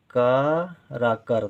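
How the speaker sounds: ordinary delivery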